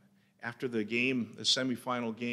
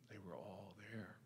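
hushed; 4 semitones lower, 21 dB quieter than usual. Acted. Hushed line at funeral memory.

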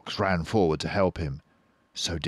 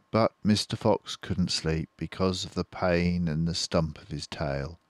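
ordinary delivery, nothing marked

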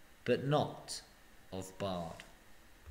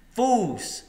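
scornful; 9 semitones higher, 6 dB louder than usual. Acted. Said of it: "said Bard".

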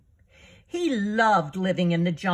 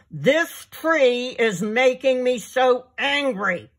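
a hammed-up furious lion, a bit louder; lion complains to snake, mock angry shout; 5 semitones higher, 4 dB louder than usual. A narrator's reading. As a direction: roar the complaint at the tree. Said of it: "shouting at the top of his lungs".

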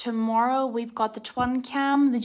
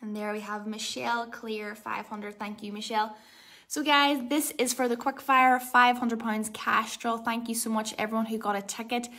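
ordinary talk, nothing marked

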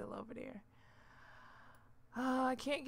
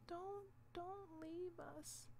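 slow, much softer begging; soft slow plea; 6 semitones higher, 11 dB quieter, slower than usual. Real, not acted. Gamer softly begging the pet not to leave, slow quiet plea. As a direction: plead gently so it won't go.

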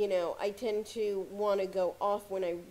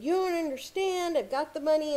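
pitched way up and louder, a pretend flare of frustration; mock upset protest; 7 semitones higher, 3 dB louder than usual. To the phone agent about a bill, pretending to lose his cool, pitched up and louder. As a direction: act out losing patience on the phone, higher and louder. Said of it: "gotten really upset and made my voice get louder".

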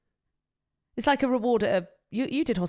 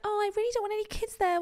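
mocking whiny guy; 11 semitones higher, 4 dB quieter, faster than usual. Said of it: "the guy is like".

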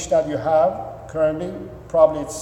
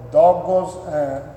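ordinary talk, nothing marked

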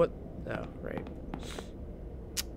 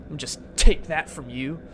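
normal talking voice

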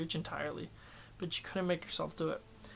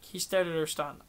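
ordinary delivery

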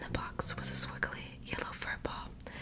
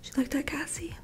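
whispered storytelling; 4 dB louder than usual. Acted. Reading Marley's story to sleepy listeners, in a whisper. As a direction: breathe the story out like a bedtime read.

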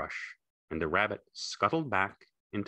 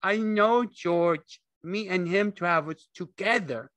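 high, loud desperate insistence; cling to it in panic; frantic; 14 semitones higher, 6 dB louder than usual. A narrator's reading. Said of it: "Lenny cried frantically".

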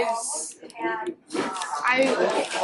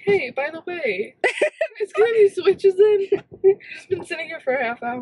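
laughing mock-casual act, pitched up and louder midway; pretend casual shopper; 4 semitones higher, 6 dB louder than usual. Friend rehearsing a shopper act in line, laughing mock-casual. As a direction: play an innocent shopper and crack up. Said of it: "We could just pretend like we're shopping".

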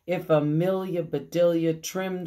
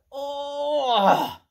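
tender cry; 9 semitones higher, 5 dB louder than usual. Acted. Hugging Jesus.

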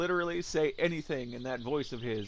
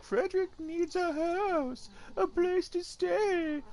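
much higher, slow, sing-song imitation of the roommate; mocking roommate voice; 14 semitones higher, slower than usual. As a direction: parody how the roommate sounds in his head. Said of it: "that's how you talk".

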